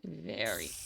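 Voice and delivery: low croaking word; hoarse croak. Real, not acted. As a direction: voice cracking down low. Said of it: "I am losing my voice".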